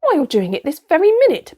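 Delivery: loud, high, exasperated bellow; indignant shout. A narrator's reading. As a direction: bellow the accusation at him. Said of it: "bellowed Trumpkin".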